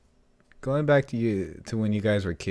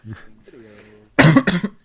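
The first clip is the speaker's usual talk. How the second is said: voice crack; 7 semitones higher, 12 dB louder than usual. Real, not acted. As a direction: cough breaking high. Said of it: "[name] with the classical voice crack".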